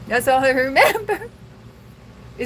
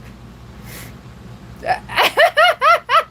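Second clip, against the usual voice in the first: a sudden high cackle of real laughter; high burst of laughter; 4 dB louder than usual. Real, not acted. Laughing at own breakup joke, loud high cackle.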